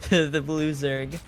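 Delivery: ring announcer intro. Pretend. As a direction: hype up the player like a ring announcer.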